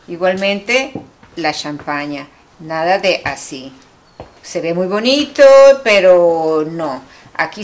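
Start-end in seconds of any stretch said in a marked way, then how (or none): none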